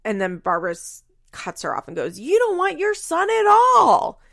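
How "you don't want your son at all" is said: high, loud, slow retold snap; hammed-up yell cutting her off; mock yell. Retold quarrel, Barbara cuts in.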